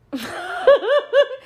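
a loud, high cackle of a laugh; high burst of laughter. Real, not acted. Laughing at the Jared Leto impression, a loud high laugh. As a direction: crack up at your own bit.

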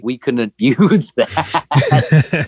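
words breaking into real laughter; high, slowed, laugh-filled delivery. Real, not acted. Tells funny story, breaking up.

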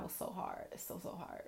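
breathy whisper, barely there. Real, not acted. Telling viewers how hard silence was, in a whisper. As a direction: let it drop to a whisper.

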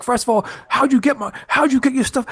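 high-pitched, indignant demand; mock mad. Pranked friend demands how he got the stuff, high mock anger. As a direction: imitate the outraged friend demanding answers. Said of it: "like, all mad".

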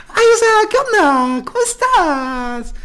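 put-on high, drawn-out ditzy rich-girl gush; exaggerated squealy niña fresa impression; Andrea. Friend mimicking how Andrea used to talk, super-fresa voice. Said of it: "súper niña fresa".

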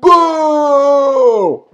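drawn-out high boo; imitated crowd boo. Podcaster imitating the crowd as the show ends, booing. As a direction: wail a long boo like an angry audience. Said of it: "all you hear is".

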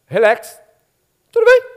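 very high squeaky falsetto; exaggerated high-pitched all-is-well; woman's feigned okay. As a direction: chirp the answer in a tiny high voice. Woman's answer, acted.

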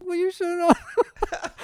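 put-on high, slow kid copycat; parrot voice. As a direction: echo it back in a tiny voice. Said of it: "he just copies it".

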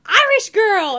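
imitating a drunk guy's shout, loud and high; mock drunk shout. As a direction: yell it across at her like a tipsy stranger. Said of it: "He's like".

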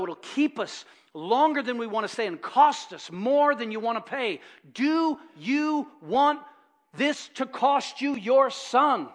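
loud, driving sermon, hammering each point home; full-force preaching. Acted. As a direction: preach it hard to the fathers, rising to a call. Preacher exhorting fathers loudly.

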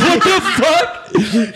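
high, slow, laughing mock disbelief; mock shock. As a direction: stretch it out in fake shock. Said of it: "And we were like".

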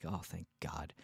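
quiet relieved breath of words; soft relief. Real, not acted. Relieved that the rooms were sorted, softly.

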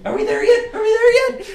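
put-on very high, loud kid voice; squealed, hammed-up child chant; pestering child. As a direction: nag like a kid in the back seat. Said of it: "I'm like a child right now".

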